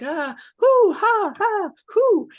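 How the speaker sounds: high, loud hooting belly laugh; hooting laughter. Retold or imitated. Showing how a laughter club peaks, in high, loud hooting laughs. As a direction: let the laugh burst out in big high hoots. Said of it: "Then people are like".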